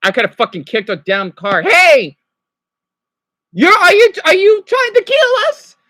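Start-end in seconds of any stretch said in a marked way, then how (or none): 1.6-5.8 much higher and louder, a shouted accusation at the driver; retold angry yell. Shouting at drivers blocking the crosswalk, loud and high. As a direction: yell at them as if your life's at stake. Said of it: "like the Sponso episode".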